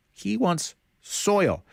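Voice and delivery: slow and marvelling on "soil"; amazed emphasis. Shown as a demonstration. Preacher to the class, marvelling at Naaman's odd request.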